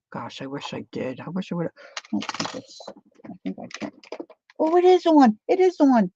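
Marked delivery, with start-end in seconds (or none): 4.6-6.1 surprised. Real, not acted. Discovering glue gun is on.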